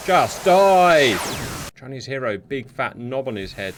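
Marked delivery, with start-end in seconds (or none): 0.1-1.2 exasperated shout. Real, not acted. Enemy plane won't go down.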